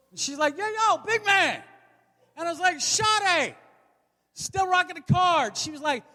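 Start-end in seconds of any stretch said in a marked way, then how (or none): 0.6-1.6 high-pitched street hail; calling out. Retold or imitated. Cookie seller hailing a big customer outside the store, calling out. 2.8-5.6 high drawn-out mock protest. Customer answering a cookie seller, high-pitched protest. Acted reply to the seller's hail.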